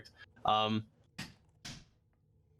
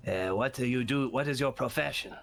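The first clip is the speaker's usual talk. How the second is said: rasping voice. Acted. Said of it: "weird rasping voice".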